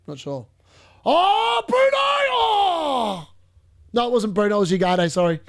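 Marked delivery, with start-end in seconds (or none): 1.0-3.3 high sudden yelp of excitement; real excited shout. Real, not acted. Watching the live game as Bruno seems to shoot, high excited shout. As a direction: jump out of your seat at the shot.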